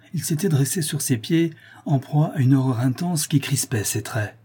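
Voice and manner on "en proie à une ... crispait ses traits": ordinary delivery, nothing marked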